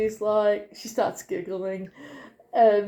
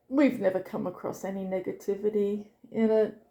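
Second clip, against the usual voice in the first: ordinary delivery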